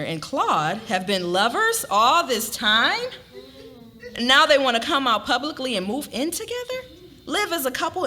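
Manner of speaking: incredulous narration. A narrator's reading. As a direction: read it as outrage. Narrator voices Lillian's shock.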